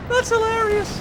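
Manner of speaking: high, loud laughing line. Real, not acted. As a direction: squeal it through laughter. Caster laughing at play.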